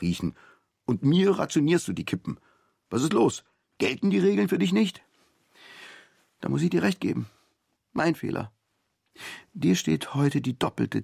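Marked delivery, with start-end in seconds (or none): none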